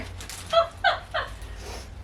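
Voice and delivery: high breathy disbelieving laugh; squeaky laugh. Real, not acted. Mother watching kids use each other as bowling pins, squeaky laugh.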